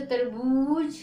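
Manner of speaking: तरबूज with बू held long and emphatic; stressed long vowel. Shown as a demonstration. Teacher showing a word said with force, तरबूज, बू stretched. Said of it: "जोर लगा के कोई शब्द".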